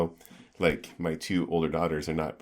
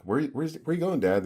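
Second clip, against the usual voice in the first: put-on higher-pitched child's question; kid's innocent question, pitched up; imitating a kid; 10 semitones higher than usual. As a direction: lightly voice a curious kid. Voices his kids asking dad.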